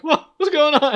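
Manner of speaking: high, loud panicked question; startled yelp. Pretend. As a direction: yelp in shock. Guy startled by raid.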